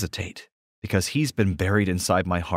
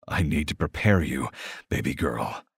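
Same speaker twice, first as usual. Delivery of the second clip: groan; 6 semitones lower, 3 dB quieter than usual. Acted. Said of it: "he groans".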